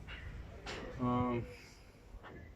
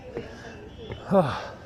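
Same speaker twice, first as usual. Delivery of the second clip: long unvoiced exhale after the climb; breathy tired sigh; 4 dB quieter than usual. Real, not acted. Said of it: "My legs are burning".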